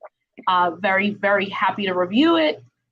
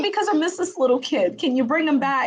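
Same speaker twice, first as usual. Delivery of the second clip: playful pleading; 6 semitones higher than usual. Retold or imitated. Retelling plea to son over pictures.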